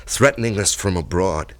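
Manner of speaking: ordinary talk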